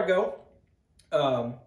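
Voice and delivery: speaking around pipe stem; pipe in mouth. Real, not acted. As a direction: lips gripping pipe. Seen 2: Pipe stem at lips early, lowered to chest after 1.0s.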